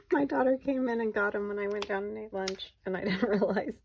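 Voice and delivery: relieved giggle at your own mix-up; amused, laughing. Real, not acted. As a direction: tell it through real laughter. Laughing at own mix-up over pens.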